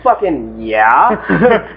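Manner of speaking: very slow, stretched-out yeah; drawn-out yeah. Retold or imitated. Fighter agreeing to sign, drawn out slowly. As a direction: stretch it out, savoring it. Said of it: "I'm like".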